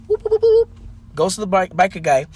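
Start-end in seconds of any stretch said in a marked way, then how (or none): 0.1-0.7 very high, loud cartoon whoops; comic whooping. Leprechaun runs down the table, cartoon whooping. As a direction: run of squeaky high whoops.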